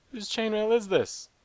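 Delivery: ordinary delivery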